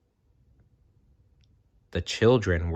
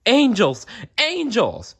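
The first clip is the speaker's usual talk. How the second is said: much higher, louder startled cry; excited shout; 4 dB louder than usual. Acted. Fleeing soldier crying out, high excited shout. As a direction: burst out as if you saw angels.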